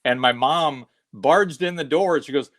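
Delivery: ordinary delivery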